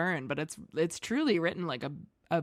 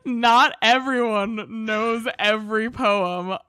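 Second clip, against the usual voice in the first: mocking repeat; 4 semitones higher, 6 dB louder, much slower than usual. Mocking repeat of partner's line.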